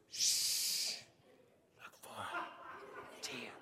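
long shush, then slow whispered words; whispering. Retold or imitated. Buddy shushes his friend to hunt on, whispering. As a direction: hush him, then whisper with long pauses. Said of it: "He's whispering".

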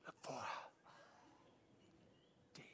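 long shush, then slow whispered words; whispering. Retold or imitated. Buddy shushes his friend to hunt on, whispering. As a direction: hush him, then whisper with long pauses. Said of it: "He's whispering".

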